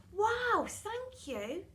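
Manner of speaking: put-on high, eager child voice; delighted child. Pupil thanking Miss Seeth for present, excited. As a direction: squeal your thanks like a kid.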